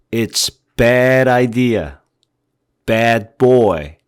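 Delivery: bad idea slow, vowel dropped low; bad said deep from the throat. Shown as a demonstration. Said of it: "소리를 아래로 내리죠". (목으로 내린 bad)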